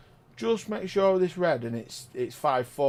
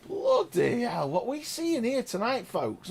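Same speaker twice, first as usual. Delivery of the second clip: high thrilled exclaim; 7 semitones higher than usual. Real, not acted. Still thrilled by play.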